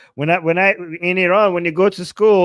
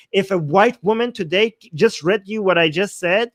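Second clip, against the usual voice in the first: ordinary talk, nothing marked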